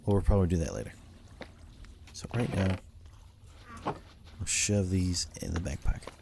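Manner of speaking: normal talking voice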